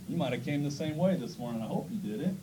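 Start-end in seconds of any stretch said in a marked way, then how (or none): none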